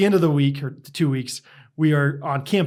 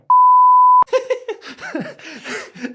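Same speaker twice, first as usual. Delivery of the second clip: high laughing outburst, louder; helpless high laugh; real burst of laughter; 8 dB louder than usual. Real, not acted. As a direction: crack up at the punchline. Punchline of the camp story.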